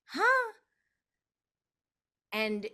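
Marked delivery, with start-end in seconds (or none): 0.1-0.5 high, loud bewildered huh; baffled huh. Real, not acted. Hearing she'll be the doula, high and baffled. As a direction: caught completely off guard. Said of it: "reacts to the message".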